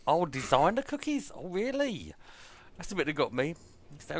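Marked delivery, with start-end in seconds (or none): 0.1-2.1 mocking. Mocking her designer cookies.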